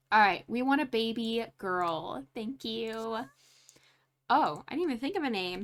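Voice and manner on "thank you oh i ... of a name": normal talking voice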